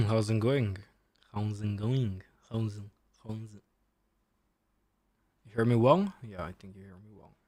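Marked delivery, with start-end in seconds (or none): none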